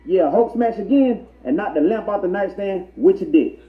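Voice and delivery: ordinary delivery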